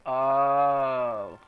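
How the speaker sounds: normal talking voice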